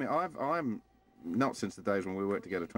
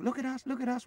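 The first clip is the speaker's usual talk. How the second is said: playful high call. Pretend. Imagined crew calling the dog.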